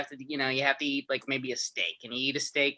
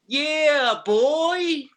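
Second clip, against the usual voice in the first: high, loud hype-man shout; 13 semitones higher, 7 dB louder than usual. Retold or imitated. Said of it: "This is [name]".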